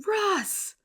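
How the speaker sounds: very high mock yell; high-pitched calling-out, imitated. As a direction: yell the name as a panicked movie dad would, held back in volume. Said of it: "and Clark just yells".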